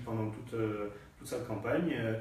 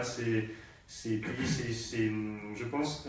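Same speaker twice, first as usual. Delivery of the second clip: ordinary talk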